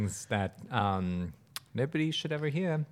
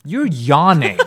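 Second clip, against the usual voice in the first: ordinary talk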